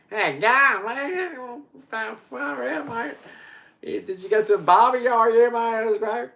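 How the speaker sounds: high, loud ocker Aussie bloke; hammed-up Aussie mate, cranked up high; broad Australian accent. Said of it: "that's how they say it in Australia".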